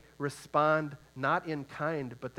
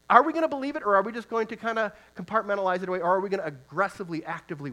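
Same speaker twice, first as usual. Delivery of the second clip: much higher and louder, fast, urging the crowd; fervent preaching shout; 5 semitones higher, 5 dB louder, faster than usual. Real, not acted. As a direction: fire the questions at them, quick and loud. Preacher's challenge to congregation.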